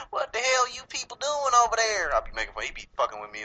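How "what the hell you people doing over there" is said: high-pitched hammed-up Southern twang; mock Southern accent. Making fun of Southern family, mocking high Southern accent. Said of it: "fucking with him about his accent".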